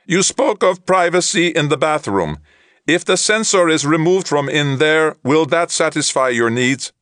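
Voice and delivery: high flat alien translator; even machine delivery, no feeling; monotone translator voice. A narrator's reading. Troft translator voice.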